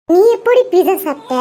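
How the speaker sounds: a lightly voiced curious child. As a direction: play the small kid asking mom. Child voice asks mother.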